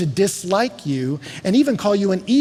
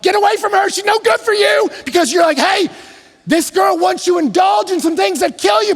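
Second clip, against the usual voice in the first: put-on high, loud shout warning the boy off; mock yell at the TV; 13 semitones higher, 6 dB louder than usual. Preacher yells at the TV boy to leave his girlfriend, loud and high. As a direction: yell at the screen like a worried fan. Yelling at the TV show.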